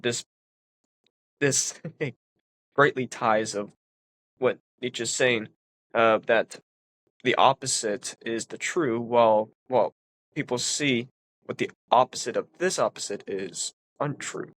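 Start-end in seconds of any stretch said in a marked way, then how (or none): none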